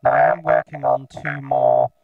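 robotic vocoder voice on a synth tone; vocoder sound. Shown as a demonstration. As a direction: talk as a buzzing, synthesised voice. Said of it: "that's the sound that the vocoder makes".

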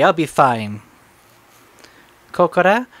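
normal talking voice

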